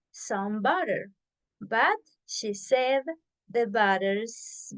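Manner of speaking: slow, word by word; slow it down so learners follow. Shown as a demonstration. Teacher to class, modelling the twister for practice, slow and deliberate. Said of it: "let's do it slow".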